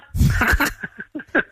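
amused burst of laughter; real spontaneous laugh. Real, not acted. Amused by son's early arrival.

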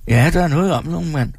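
a hint of philosophical old man; lightly voiced old worker. A narrator's reading. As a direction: muse knowingly. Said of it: "nikker filosofisk". (gammel arbejder der filosoferer)

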